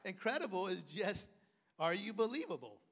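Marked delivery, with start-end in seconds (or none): none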